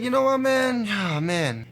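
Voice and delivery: high, drawn-out mock exasperation, dropping on the sigh; reluctant giving in. Fisherman caving to the fish's nagging, reluctant giving in.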